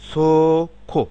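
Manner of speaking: সোখো said high and loud; loud model word. Shown as a demonstration. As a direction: present সোখো loudly as the example. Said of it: "যেমন".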